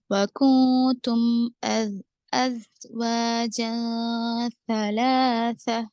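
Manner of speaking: slow, drawn-out tajweed chant; Quranic recitation. Shown as a demonstration. Tajweed teacher reciting for students, slow measured chant. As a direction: recite the verse slowly with elongated vowels.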